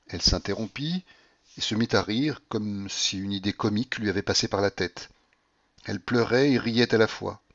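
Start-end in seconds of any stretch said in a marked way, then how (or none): none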